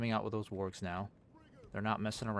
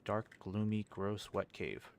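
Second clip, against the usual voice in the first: disgusted; 6 dB quieter, slower than usual. Real, not acted. Describing goblin cave with disgust.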